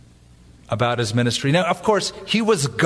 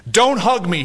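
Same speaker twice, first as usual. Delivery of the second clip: understated angry growl, a little louder; mock growl; 7 semitones higher, 4 dB louder than usual. Hostile pastor rebuffing a friend's hug, mildly growled. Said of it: "he growled".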